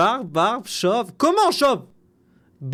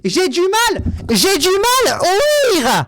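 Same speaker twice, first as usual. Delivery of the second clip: higher, louder and very slow, dragging out each word; slow hammed-up yell of not hearing; drawn-out mock shout; 8 semitones higher, 6 dB louder, much slower than usual. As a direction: stretch it out loud as if shouting to someone deaf. Pretends not to hear comment.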